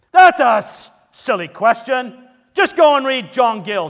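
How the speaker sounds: loud, overbearing dismissal, pitched high; stern. Retold or imitated. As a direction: cut him down like a domineering elder. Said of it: "stern individual".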